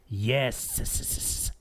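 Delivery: "Yes" ending in repeated hissed s-s-s; extra S's. Retold or imitated. Reading a creepy chat reply, "Yes" with stuttered extra S's. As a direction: draw out the final s of "Yes" in pulses. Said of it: "Three S's".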